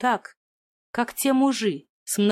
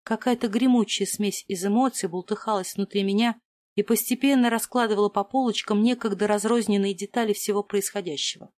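usual speaking voice

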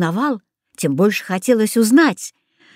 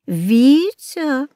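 father calling his son in an odd, altered voice; a strangely shifted voice on the name; the father's voice suddenly sounds odd; 4 semitones higher than usual. A narrator's reading. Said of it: "каким-то странным голосом сказал папа".

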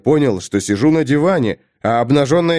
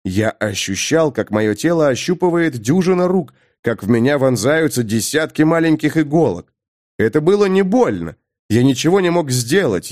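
ordinary delivery, nothing marked

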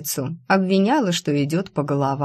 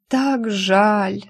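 higher, theatrical moan of pity; 5 semitones higher than usual. A narrator's reading. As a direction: moan it like a tragic heroine. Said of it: "простонала".

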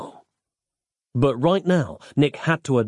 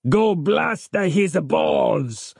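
slow, higher-pitched vengeful command; ordering; 4 semitones higher, much slower than usual. A narrator's reading. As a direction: give the order word by word. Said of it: "ordered Giuseppe".

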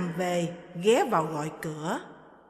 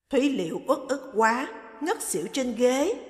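usual speaking voice

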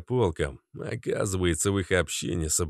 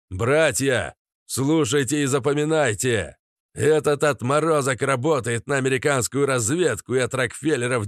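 loud, high speech to a crowd; rally speech; 4 semitones higher, 4 dB louder than usual. Acted. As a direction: rouse the crowd against him. Addressing the crowd, accusing.

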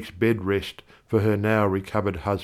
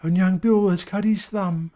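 much higher voice for the wife, mumbled; mumbling wife; 9 semitones higher than usual. Acted. Wife with pegs in her mouth, high mumble. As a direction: mumble as if round clothes pegs. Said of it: "she mumbles".

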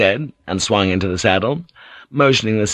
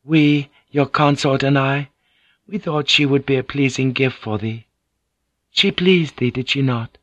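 higher-pitched lady's voice, composed; Mariko, explaining; 5 semitones higher than usual. Acted. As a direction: explain it politely in a raised register. Narrator voices Mariko in dialogue.

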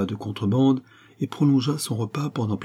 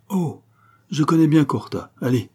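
higher-pitched, artless eagerness; naive air; 6 semitones higher, 4 dB louder than usual. A narrator's reading. Venture claiming to know Corta, naively. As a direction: play the innocent who knows the place. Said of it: "fit Venture d'un air naïf".

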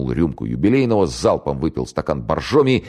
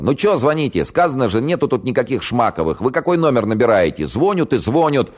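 high, loud, cranky yelling into the phone; grumpy shout; 9 semitones higher, 3 dB louder than usual. Acted. Valiulina yelling grumpily at the caller, loud and shrill-free high. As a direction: bark it like a fed-up neighbour. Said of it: "сварливо закричала".

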